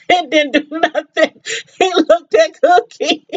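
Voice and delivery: talking through real laughter; can't stop laughing while telling it. Real, not acted. Describing the punch to viewers, laughing through it.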